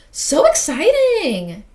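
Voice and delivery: high, loud, drawn-out excitement; excited squeal. Real, not acted. Player reacting to a game preview, excited squeal. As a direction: squeal it, stretching the words.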